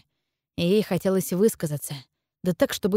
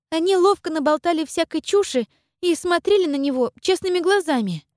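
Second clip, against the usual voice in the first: put-on high, earnest girlish voice; young girl voice; 9 semitones higher than usual. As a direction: speak as a determined young girl. Girl character held voice.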